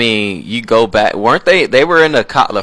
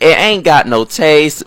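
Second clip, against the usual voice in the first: slow, louder Southern "ain't got no taste"; Southern accent; 4 semitones higher, 4 dB louder, slower than usual. Pretend. Host insisting paprika has no taste, slow Southern accent. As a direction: lean into a Southern auntie accent. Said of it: "let me stop talking Southern".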